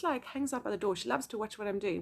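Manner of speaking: normal talking voice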